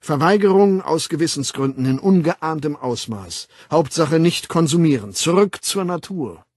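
loud, emphatic orator; lecturing World Controller. Acted. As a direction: proclaim it to the students. Weltaufsichtsrat doziert. (laut und nachdrücklich dozierend)

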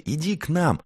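high, eager invitation; clamoring invitation. A narrator's reading. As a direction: call a new friend over happily. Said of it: "загалдели все".

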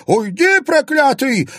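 high, loud, slow crone wail; wailing yell. A narrator's reading. Old woman wails at the man gripping her, high and loud. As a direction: howl at him to let go. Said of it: "продолжала голосить старуха".

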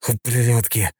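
hissed through teeth. A narrator's reading. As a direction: spit Ублюдки out under the breath. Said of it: "прошипел он сквозь зубы".

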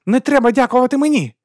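high, loud cry of fury; angry shout. A narrator's reading. Woman rejects the duke's thanks, shouting. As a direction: shout it back, voice pitched right up. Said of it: "крикнула вона".

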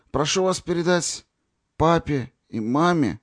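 slow, pausing after each word; dying boy forcing out every word; halting, word by word. A narrator's reading. Dying cadet to Natasha, slow and halting. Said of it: "останавливаясь на каждом слове".

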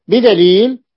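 بدليل said loud and rising; emphatic stress. Shown as a demonstration. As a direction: throw out بدليل like a challenge. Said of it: "teacher stresses the proof".

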